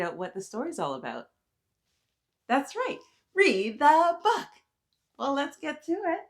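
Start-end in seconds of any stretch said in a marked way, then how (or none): 2.5-6.2 much higher and louder cheerful answer; excited answer. Acted. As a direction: proclaim it like a happy teacher. Cheerful answer to kids' question.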